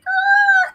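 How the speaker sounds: high, loud sharp Ah; sharp warning Ah. Retold or imitated. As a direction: stop her with one sharp Ah. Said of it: "And the Lord said".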